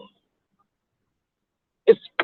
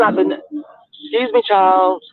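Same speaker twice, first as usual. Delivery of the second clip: sassy retort; much slower than usual. Retold or imitated.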